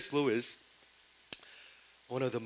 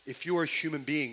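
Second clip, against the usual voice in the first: ordinary talk, nothing marked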